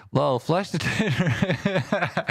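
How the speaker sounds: high, very slow, laughing through it; laughing squeak. Pretend. Streamer giggles a silly line to chat in a high voice.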